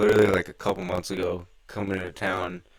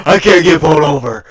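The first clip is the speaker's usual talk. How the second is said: panicked shout; 7 semitones higher, 15 dB louder than usual. Retold or imitated. Panicked retold line in car.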